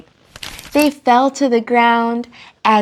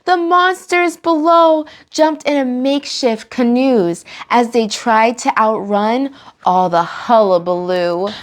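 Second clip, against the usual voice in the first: ordinary delivery, nothing marked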